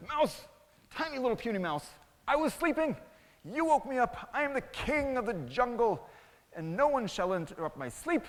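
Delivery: high, indignant royal outburst; angry lion king. Acted. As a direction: scold the tiny intruder like an outraged king.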